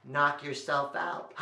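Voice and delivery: plain reading voice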